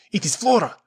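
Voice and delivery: high, loud, horrified cry; terrified. A narrator's reading. Esteban facing Flora's ghost, loud terrified cry. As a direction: shout it as if seeing a ghost.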